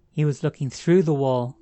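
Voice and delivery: "through" hit hard and loud; stress on THROUGH. A narrator's reading. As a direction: punch "through" as the dreadful realization. Narrator's horrified realization about the wall.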